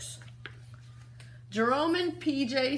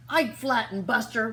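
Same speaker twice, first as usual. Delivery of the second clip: usual speaking voice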